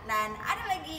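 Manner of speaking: booming prize announcer. Acted.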